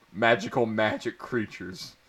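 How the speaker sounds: ordinary delivery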